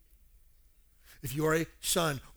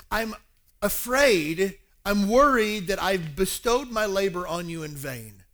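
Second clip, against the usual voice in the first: louder, emphatic worry; worried; 4 semitones higher, 7 dB louder, slower than usual. Retold or imitated. Paul fearing his labor was wasted, worried. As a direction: press the worry home with force. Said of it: "Paul had even said this".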